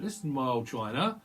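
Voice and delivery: a cheeky London market trader; a hint of a Cockney wide boy. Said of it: "slightly co-, Cockney person".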